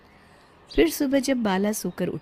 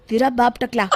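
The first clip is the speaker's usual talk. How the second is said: taunt; 5 semitones higher, 5 dB louder than usual. Acted. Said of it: "जाते जाते कहता है".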